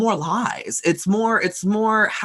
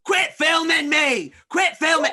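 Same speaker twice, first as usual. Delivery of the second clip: angry yell; 9 semitones higher than usual. Acted. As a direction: shout her down, furious. Man yelling at woman filming.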